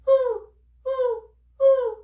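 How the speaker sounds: very high, loud short hoots; quick owl puffs together; owl breaths. Shown as a demonstration.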